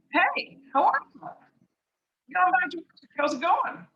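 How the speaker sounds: high-pitched, chatty hello; neighborly greeting. Acted. Executive neighbor coming out on a Saturday, breezy greeting. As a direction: greet a neighbor in a lifted, sing-out voice.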